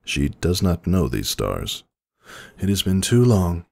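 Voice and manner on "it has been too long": higher, gentle princess voice; Celestia's voice. A narrator's reading. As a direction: speak as an ancient, weary princess.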